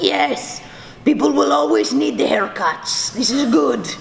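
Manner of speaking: imitating dad in a thick Spanish accent; emphatic Spanish-accented dad, pleased and approving; dad's Spanish accent. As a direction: do a Spanish father giving his approval. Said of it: "when I do the accent".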